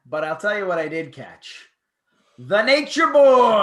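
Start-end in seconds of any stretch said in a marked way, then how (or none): 2.4-3.6 loud, slow announcer hype; hype call. Real, not acted. Podcast host hyping his co-host, slow loud call.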